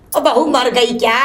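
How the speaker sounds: usual speaking voice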